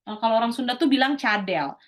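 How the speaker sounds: normal talking voice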